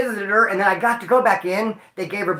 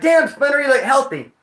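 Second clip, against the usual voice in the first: high, louder mock praise from commenters; mock-impressed commenters; 7 semitones higher, 4 dB louder than usual. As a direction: toss it off like an amazed fan. Voicing people's comments online.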